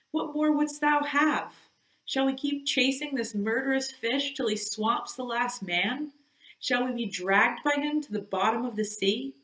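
Starbuck's voice, rising high and urgent as he begs; pleading first mate. Acted. First mate begging Ahab to abandon the hunt, urgent and imploring. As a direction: implore the captain to stop the chase.

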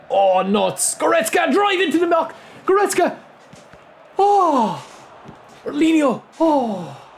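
high-pitched screaming at the play; frantic excitement. Real, not acted. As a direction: yell at your players as the chance slips. Attack builds to near goal.

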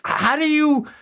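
high-pitched, incredulous mock homeowner; exasperated mock suggestion. Podcast host mocks neighbors who never clean up, high and incredulous. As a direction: pitch it up like you can't believe they won't clean.

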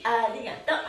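ordinary talk, nothing marked

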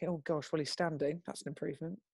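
quiet, understated reaction; hushed aside. Retold or imitated. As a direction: say it under your breath to yourself. Muttered reaction to small miracle.

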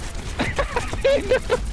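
high-pitched cackling laugh. Real, not acted. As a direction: giggle way up high.

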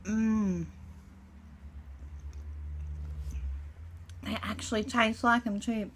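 muffled words through food; talking between bites; chewing with mouth full. Real, not acted. Seen 2: Cheeks bulging, lips closed and chewing after the bite; snack held up.

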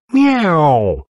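high, imitated meow; cat's meow. A narrator's reading. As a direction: mew high like a cat popping out. Cat jumps out of picture.

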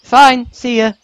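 high, loud huffy brush-off; hothead character. Acted. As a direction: toss it off like a hotheaded girl. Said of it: "She's a hothead".

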